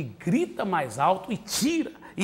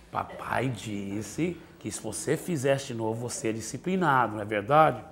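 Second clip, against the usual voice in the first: gentle, loving; 8 semitones lower, 5 dB quieter than usual. Shown as a demonstration. Father calmly reminding son of the warning, gentle. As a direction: patiently remind with love. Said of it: "com amor, com carinho".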